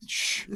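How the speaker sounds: unvoiced hushing sound; library shush. Real, not acted. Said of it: "We're recording in a library".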